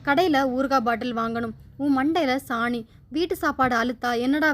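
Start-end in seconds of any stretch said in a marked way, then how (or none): none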